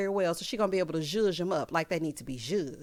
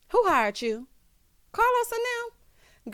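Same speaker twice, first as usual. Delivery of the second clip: incredulous; 13 semitones higher, 3 dB louder than usual. Real, not acted. Mocking the hiring claim.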